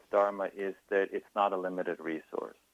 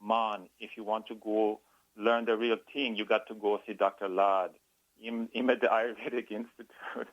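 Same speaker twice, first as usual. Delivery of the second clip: put-on Jamaican accent; 2 semitones higher than usual. Guest quoting his Jamaican meditation teacher's advice, rough Jamaican accent. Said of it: "ruin his accent".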